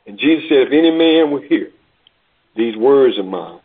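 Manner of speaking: earnest preaching. Acted. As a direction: bring it home gently to the congregation. Sermon application.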